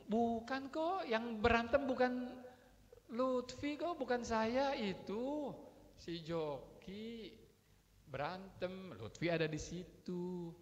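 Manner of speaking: lightly acted child pleading innocence; anak membela diri. Acting a child's protesting excuse. (anak membela diri, diulur-ulur)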